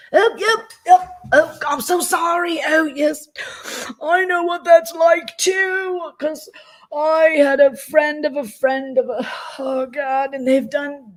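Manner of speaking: high, gushing fake-sympathetic voice; mock simpering sympathy. Mocking the streamer's fake sympathy, high and gushing. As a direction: ham up a phony caring man.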